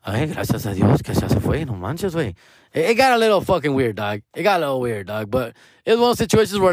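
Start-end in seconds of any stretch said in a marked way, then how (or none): 0.1-2.3 a sigh of relief in Spanish, in another timbre; relieved Spanish. Retold or imitated. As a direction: let it out like a weight off your chest. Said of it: "And I'm just like".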